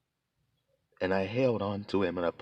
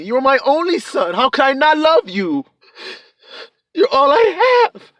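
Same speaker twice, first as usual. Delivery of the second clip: father's plea; 12 dB louder than usual. Acted. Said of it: "That's what he told me".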